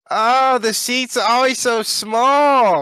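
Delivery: put-on high, slow, drawn-out whining old woman; fussy old lady. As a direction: squeal it out like a fussy little old lady asking everyone. Imitating the seatmate again.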